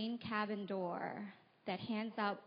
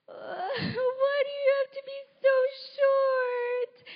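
loud, high mock-crying wail; 14 semitones higher, 12 dB louder, slower than usual. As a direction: bawl it out like a hammed-up tantrum. Acts out wailing at her mother.

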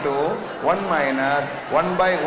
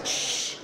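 sharp unvoiced shush to hush the room; 8 dB quieter than usual. Real, not acted. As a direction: hush the class with a long shh. Teacher hushing the class.

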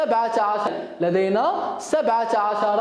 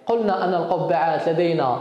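ordinary talk

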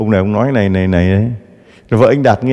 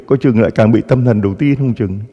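ordinary delivery

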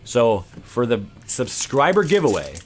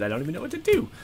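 giddy excitement; 11 semitones higher, faster than usual. Real, not acted. Nervous excitement over cards.

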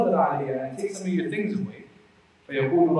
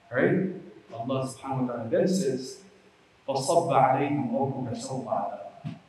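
ordinary talk

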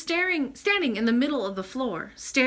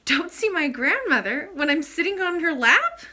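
high, loud, indignant princess; astonished child's protesting question; incredulous exclamation; 8 semitones higher, 6 dB louder than usual. A narrator's reading. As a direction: exclaim in disbelief, pitched right up. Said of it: "exclaimed the princess".

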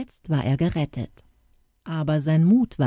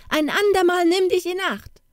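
put-on the warning again, pitched much higher as a boy's voice; boy's high call; 13 semitones higher than usual. A narrator's reading. Jakob warning the poodle he is choking, high call. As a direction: go up high, a small boy telling the dog off. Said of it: "rief er".

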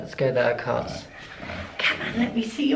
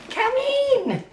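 ordinary talk

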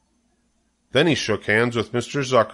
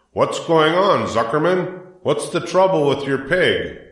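alarmed call; 6 semitones higher than usual. A narrator's reading. Loudspeaker calling to Zuckerman about his fainted pig, alarmed. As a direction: sound the alarm over the speakers.

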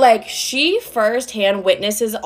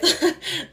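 short high giggle; bright little laugh; 8 semitones higher, 4 dB quieter than usual. Real, not acted. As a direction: laugh mid-story. Laughing while telling story.

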